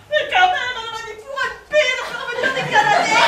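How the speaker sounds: high-pitched, loud outburst of indignation; indignant protest. Acted. Woman protesting to her friend about the firefighters sending Canadairs, comic indignation.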